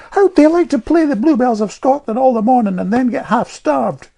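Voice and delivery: put-on high, loud indignant girl; bitter child. A narrator's reading. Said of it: "she asked herself bitterly".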